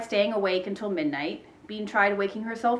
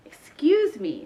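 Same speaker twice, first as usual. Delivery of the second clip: higher-pitched, polite excuse; 6 semitones higher, 3 dB louder, slower than usual. A narrator's reading. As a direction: excuse yourself with sing-out courtesy. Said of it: "said Ivy politely".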